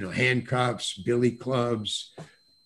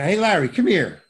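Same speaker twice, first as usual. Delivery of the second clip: lightly imitated director's summons, louder and higher; casual director calling out; 5 semitones higher, 5 dB louder than usual. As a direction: call him over like a buddy. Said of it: "You know, just like that".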